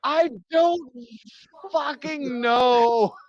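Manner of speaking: high, drawn-out exasperated wail; desperate outburst. Acted. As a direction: wail it slowly at breaking point. Tormented man answers the threat.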